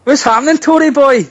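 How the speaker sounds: high, mocking hello; teasing greeting. Caller teasing Alex as a Tory boy, high and mocking. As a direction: needle him with a singsong hello.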